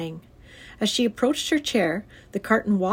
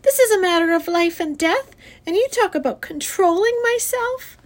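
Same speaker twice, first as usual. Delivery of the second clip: put-on high, loud child's outburst; outraged, pitched-up yelling; indignant yell; 11 semitones higher, 5 dB louder than usual. A narrator's reading. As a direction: protest at the top of your pitch. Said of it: "yelled Fern".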